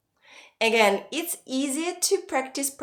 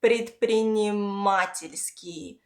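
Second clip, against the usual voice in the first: предпринимательский slowly, with long stressed "ма". Shown as a demonstration. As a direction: stretch the "ма" in предпринимательский. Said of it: "the stress falls on".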